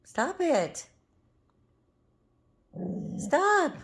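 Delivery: high, sing-song telling-off for the dog; high pet-scolding voice. Real, not acted. Owner scolding her growling dog, high pet voice. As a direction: squeak a firm stop at the growling puppy.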